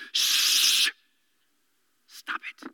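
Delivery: hushed, breathy shushing like a dad. Retold or imitated. Jesus hushing the storm in a dad voice. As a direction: shush the sea like a tired dad. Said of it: "In his best dad voice".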